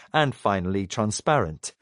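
usual speaking voice

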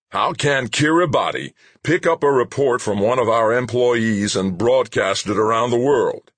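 high-pitched, outraged question; indignant demand. A narrator's reading. Boss rounding on the head of legal, indignant demand. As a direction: demand an answer, incensed. Boss whirls on his lawyer.

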